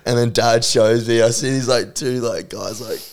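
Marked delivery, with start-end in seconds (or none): none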